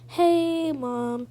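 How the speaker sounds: ordinary delivery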